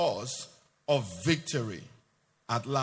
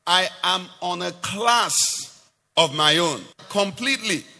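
raised, emphatic voice of God; God declaring; 3 semitones higher, 4 dB louder than usual. Acted. Preacher voicing God's claim to a class apart, emphatic declaration. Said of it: "He's saying".

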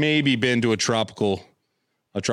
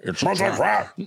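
a hint of creature voice; lightly voiced alien admiral. Speaking as the Ackbar figure about its tan, light creature voice. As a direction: lightly put on an alien commander's voice.

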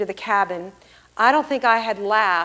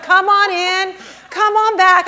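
nonchalant, frolicky; 11 semitones higher, 12 dB louder than usual. Retold or imitated. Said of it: "very nonchalant, very frolicky".

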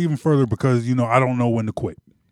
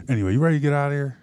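normal talking voice

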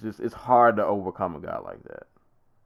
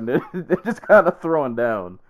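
laughing; 5 semitones higher, 5 dB louder than usual. Real, not acted. Laughing while describing fight.

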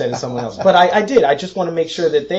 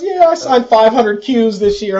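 higher, louder, slow bragging voice; boastful rival voice; 10 semitones higher, 8 dB louder, slower than usual. Retold or imitated. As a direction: brag it like a smug rival. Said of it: "He goes".

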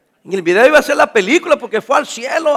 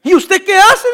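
"¿Y usted qué hace?" asked higher and louder, rising at the end; loud incredulous question; 7 semitones higher, 5 dB louder, slower than usual. Retold or imitated. Preacher repeating the man's question, loud and incredulous. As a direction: call it out in loud disbelief. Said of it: "preacher repeats the quote louder".

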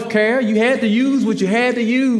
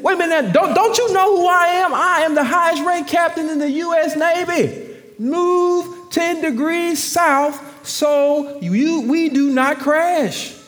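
irritated captain; 8 semitones higher than usual. Retold or imitated. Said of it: "Captain got irritated".

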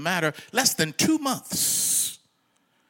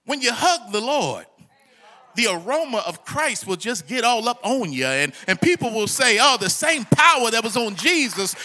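raised, loud preacher's voice; ringing sermon delivery, higher and louder; full-force preaching; 8 semitones higher, 7 dB louder than usual. Real, not acted. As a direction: preach it out to the congregation.

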